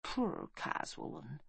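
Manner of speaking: slow, very soft dying whisper; faint, fading breath. A narrator's reading. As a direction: whisper it with pity, drawn out. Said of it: "she whispered".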